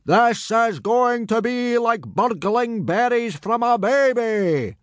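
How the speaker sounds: high, slow, drawn-out hearty boom. A narrator's reading. Gobber assuring boys the raid is easy, confident boom. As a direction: boom it out, stretching every word. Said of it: "boomed Gobber the Belch".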